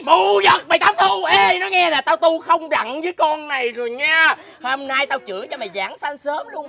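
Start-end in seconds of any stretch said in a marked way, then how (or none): 0.0-4.4 angry outburst. Acted. Fed-up neighbour squaring up to Tám Thu, angry shout. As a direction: drag out the angry threat at full voice. Snaps in a street quarrel.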